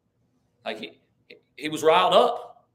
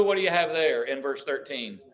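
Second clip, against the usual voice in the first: normal talking voice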